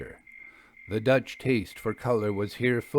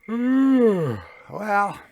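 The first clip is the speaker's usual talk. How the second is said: a high, louder yawn through "Well", then sleepy words; 10 semitones higher, 8 dB louder than usual. A narrator's reading. Jasper turning in for the night, yawning. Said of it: "said Jasper, yawning".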